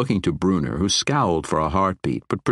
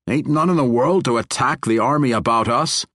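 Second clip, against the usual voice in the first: higher, louder dwarf brogue; gruff dwarf voice; 6 semitones higher, 4 dB louder than usual. Acted. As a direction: play the stubborn dwarf king, blunt and loud. Narrator voices the dwarf Bruenor.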